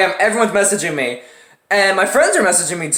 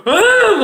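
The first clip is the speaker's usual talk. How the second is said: high pained cry; 14 semitones higher, 4 dB louder than usual. Retold or imitated. Swab twisting in nose.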